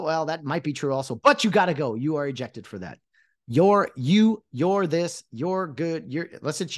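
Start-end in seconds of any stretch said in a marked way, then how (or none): none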